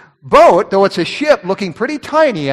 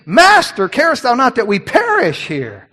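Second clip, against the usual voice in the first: acted panic, calling out for help; panicked plea; 5 semitones higher than usual. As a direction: plead in alarm, pitch jumping up. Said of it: "and they're panicking".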